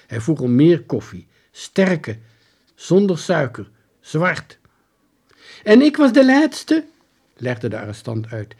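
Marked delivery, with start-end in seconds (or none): 5.6-6.9 put-on high, loud, bewildered old soldier. Soldier pleading his story to the detective, high and loud. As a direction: pitch him up high and loud as the lost soldier.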